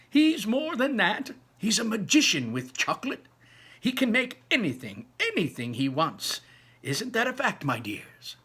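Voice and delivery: high, loud and marvelling; Grandpa Joe excited. Acted. Grandpa Joe boasting of Wonka to the family, high excited voice. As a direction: sell it as pure wonder.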